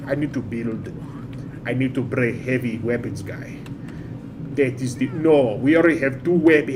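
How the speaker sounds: put-on makeshift Russian accent drifting towards African. Streamer talking through team choices in character, Russian accent. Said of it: "this is getting more African".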